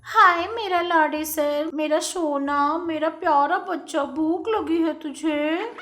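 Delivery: put-on slow, cooing baby talk; doting mother. A narrator's reading. Mother cooing to her hungry son, slow and sweet. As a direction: coo over her spoilt boy. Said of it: "इस तरह लाड करते हुए".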